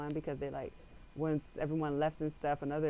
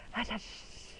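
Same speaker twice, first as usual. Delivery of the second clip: breathy hush, no voice; hiding friends whisper to be quiet; whispered shushing; 6 semitones higher, 10 dB quieter, slower than usual. Retold or imitated. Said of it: "I hear, like".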